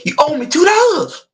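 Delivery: mock-demand the two dollars. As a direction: kid mimicking a movie character asking for money.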